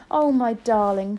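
loud, slow loving exclamation; emotional cry. A narrator's reading. Grandmother giving a hug, crying out fondly. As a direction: draw it out fondly and loudly. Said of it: "she cried".

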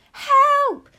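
very high, very loud cry; scream for help. A narrator's reading. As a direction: scream it in panic. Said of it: "screamed Missus Jenkins".

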